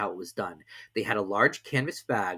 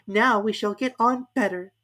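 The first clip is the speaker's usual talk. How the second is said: Alice musing; 11 semitones higher, 5 dB louder than usual. A narrator's reading. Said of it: "thought Alice".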